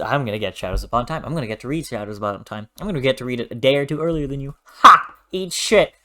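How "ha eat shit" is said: loud, high mocking crow of triumph; cheeky gloat at the viewers; gloating taunt. Real, not acted. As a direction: rub it in with a cheeky bark. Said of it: "I gotta gloat".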